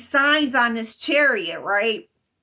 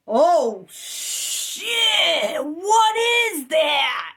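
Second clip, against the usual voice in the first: shouted higher and slowed, frightened surprise; startled shout; 6 semitones higher, much slower than usual. Retold or imitated. Dreamer looking back at the sky, startled shout. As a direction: yell it out as the sky splits open. Looks back, sees the god.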